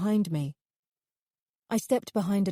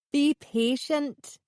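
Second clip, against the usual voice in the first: chuckling; 8 semitones higher, much slower than usual. A narrator's reading. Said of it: "he said, chuckling".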